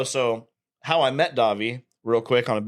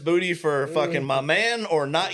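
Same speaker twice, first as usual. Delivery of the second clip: higher-pitched imitation of a dismissive woman; mock gym-girl brush-off; 5 semitones higher than usual. Storyteller imitates a woman at the gym rebuffing him, mock brush-off. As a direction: lightly voice her shutting down an approach. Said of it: "Yeah, R B F".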